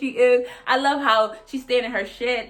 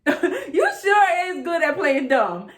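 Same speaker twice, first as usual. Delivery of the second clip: slow, higher, laughing jab; laughing taunt; 7 semitones higher, 4 dB louder, slower than usual. Real, not acted. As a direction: savor the insult through a laugh.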